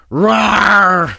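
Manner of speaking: playful mock bear growl; a cute little rawr like a bear; bear roar. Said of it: "Bears reached for comment".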